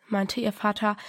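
ordinary delivery, nothing marked

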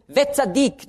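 Wetzadik said high and set apart; stressed Hebrew word. Shown as a demonstration. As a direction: pitch Wetzadik up as the accent. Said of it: "Im Römerbrief". (Wetzadik hoch betont)

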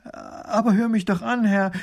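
desperate high-pitched plea; verzweifelt. A narrator's reading. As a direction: beg to be heard. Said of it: "ruft die Frau verzweifelt". (verzweifelt flehend, mit hoher Stimme)

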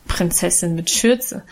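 good-natured mockery. A narrator's reading. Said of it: "spottete Guste gutmütig lachend".

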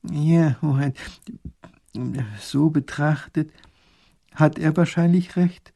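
plain reading voice

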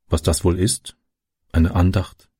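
puzzled wondering to oneself; understated musing; pondering. A narrator's reading. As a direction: mull it over as a question. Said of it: "überlegte Francis".

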